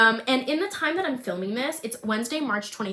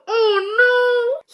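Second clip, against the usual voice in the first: high, loud crying oh no; sobbing, much higher and louder; 13 semitones higher, 10 dB louder than usual. Real, not acted. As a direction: wail it out in real tears. Overcome while reading her scene.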